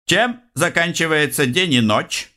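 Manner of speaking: high-pitched teasing riddle; secretary voice, high. Acted. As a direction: ask it like a gleeful quizmaster.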